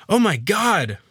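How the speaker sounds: high, startled exclamation; gasped shock. A narrator's reading. Man sees his reflection in the mirror, shocked gasp. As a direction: exclaim it in shock, pitch jumping. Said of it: "I gasped".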